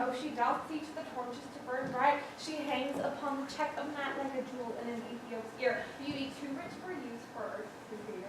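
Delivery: soft, quick verse recital; hushed, rapt Shakespearean praise; poetic love aside. Acted. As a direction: recite love poetry under your breath.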